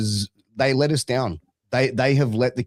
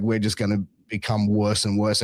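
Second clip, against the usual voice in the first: normal talking voice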